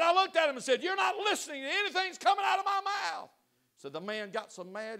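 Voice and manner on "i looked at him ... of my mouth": retold shout. Counselor's retold outburst at couple.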